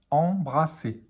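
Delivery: em-bra-sser, split into syllables. Shown as a demonstration. Teacher sounding out a word for beginning readers, syllable by syllable. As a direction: say "embrasser" in separate syllables.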